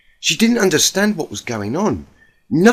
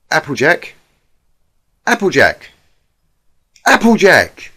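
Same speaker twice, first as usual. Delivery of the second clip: calls her name, each time louder; calling out, building; 3 semitones higher, 6 dB louder than usual. Acted. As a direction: call her name, rising to a shout. Twilight calling Applejack, building.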